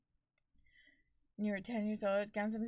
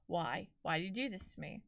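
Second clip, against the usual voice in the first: weary plea; 4 semitones lower, 7 dB quieter than usual. Retold or imitated. Acted plea after landlord's delay.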